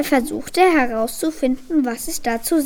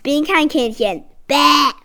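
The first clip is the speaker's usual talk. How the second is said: cheeky creature speaking up, loud final "Bäh"; loud and distinct retort; piercing, clear voice; 2 semitones higher, 5 dB louder than usual. A narrator's reading. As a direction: pipe up clearly and defiantly. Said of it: "durchdringende Stimme sagte laut und deutlich".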